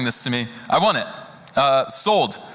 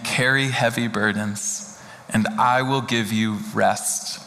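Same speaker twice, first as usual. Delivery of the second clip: slow, lower, unhurried scripture read; 3 semitones lower, slower than usual. Acted. Pastor reading Jesus' words to the congregation, slow and gentle.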